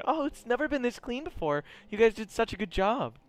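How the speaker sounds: put-on high-pitched admiring girl's voice; acted high female character; chipper girl character. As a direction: praise them in a high, sweet-girl voice. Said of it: "she starts, like, talking".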